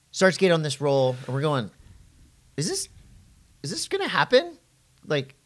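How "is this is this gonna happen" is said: high, rising hopeful question; as if hardly daring to believe it; hopeful disbelief. Retold or imitated. Retelling excited hopeful thought.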